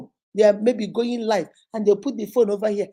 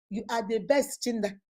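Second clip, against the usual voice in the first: normal talking voice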